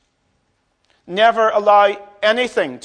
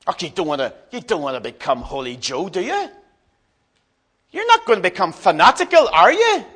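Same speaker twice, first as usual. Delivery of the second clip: needling disbelief. Retold or imitated. Spouse discouraging the other from faith, needling disbelief.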